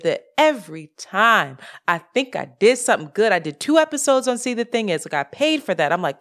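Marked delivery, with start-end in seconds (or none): none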